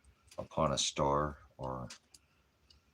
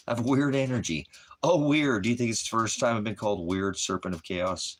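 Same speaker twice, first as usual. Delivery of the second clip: excited; 7 semitones higher, 6 dB louder than usual. Real, not acted. Excited rant at chatter.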